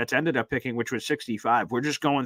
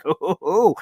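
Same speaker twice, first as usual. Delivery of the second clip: excited imitation; 6 semitones higher, 4 dB louder than usual. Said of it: "And he's like".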